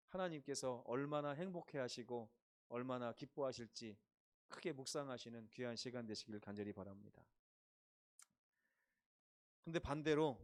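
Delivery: normal talking voice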